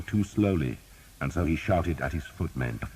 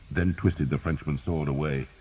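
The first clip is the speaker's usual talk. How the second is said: ordinary delivery, nothing marked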